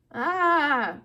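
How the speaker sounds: loud high scream; villainess's loud "Ah!"; pained yell. A narrator's reading. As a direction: cry out as if struck. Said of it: "Medusa yelled".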